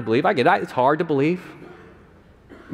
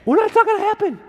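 shocked falsetto; faster than usual. Retold or imitated. Mary refusing the angel.

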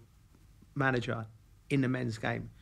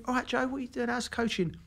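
much higher, brisk imitation of Emma; imitating Emma; 10 semitones higher than usual. Emma greeting Joe among her staff, higher and brisk. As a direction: quiz him like a busy coach. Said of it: "and I've got".

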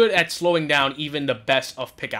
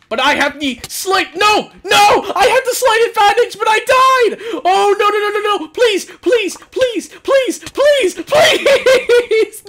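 high shouted wail of despair; panicked pleading; 10 dB louder, slower than usual. Real, not acted. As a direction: beg at the top of your voice. Dying in game, pleading.

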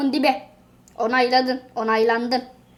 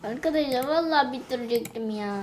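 much softer, quick deflated complaint; dejected; 7 dB quieter, faster than usual. Real, not acted. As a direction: sigh it out to the viewers. Dejected to viewers.

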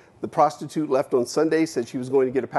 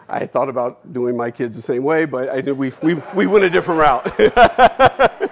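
talking through real laughter, louder at the end; laughing; 6 dB louder than usual. Real, not acted. Speaker jokes to audience about his own kids, laughing. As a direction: laugh your way through the punchline.

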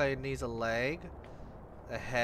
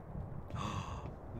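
sharp gasp; 7 semitones lower, 13 dB quieter than usual. Real, not acted. Scared gasp.

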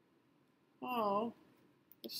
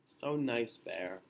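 plain reading voice

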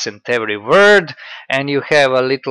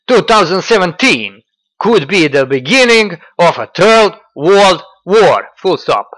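mock Russian accent, loud and high, pompous; mocking Dugin quote; 7 semitones higher, 8 dB louder than usual. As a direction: ham up Dugin's grand proclamation, dictating punctuation. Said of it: "I will quote".

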